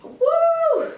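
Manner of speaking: high whooping cheer; excited whoop. Real, not acted. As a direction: whoop it up after the shot. Cheer after taking shot.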